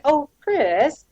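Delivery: high, rising coax on Chris; mock sweet-talking Chris; wheedling. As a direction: draw the name out like a sly ask. Boss wheedling on phone.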